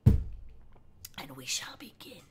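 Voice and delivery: slow, hushed whisper to open the story; spooky whisper. Acted. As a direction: breathe it like a campfire tale. Ominous start of reading.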